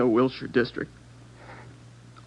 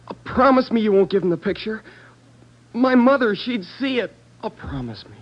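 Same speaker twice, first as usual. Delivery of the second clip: tearful begging; 6 semitones higher than usual. Acted. Son begs detectives to spare his mother the photo, tearful.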